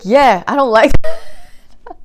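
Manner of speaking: slow, loud, laughing yeah of dislike; mock disgusted friend. Friend laughing and loud while turning down the perfume. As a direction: drag it out like he hates it.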